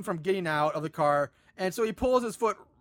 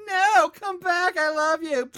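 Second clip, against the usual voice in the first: slow, higher, louder cry; mock desperate plea; 7 semitones higher, 4 dB louder, slower than usual. Shane begging John to come back, mock-tragic plea. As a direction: call after him in hammed-up despair. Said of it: "Shane's like".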